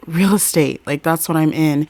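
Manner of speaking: loud defensive son; loud son answering his angry dad. Retold or imitated. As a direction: fire it back at the father. Son snaps back at dad.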